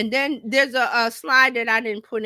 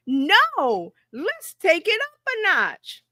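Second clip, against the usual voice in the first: high-pitched, drawn-out hyped reply; fired-up AI reply; 8 semitones higher, much slower than usual. Retold or imitated. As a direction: imitate an assistant raising the stakes. Voices AI answering her challenge.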